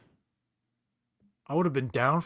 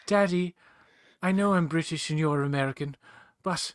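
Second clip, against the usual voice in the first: put-on British accent; 3 semitones higher, 3 dB louder than usual. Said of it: "It's like".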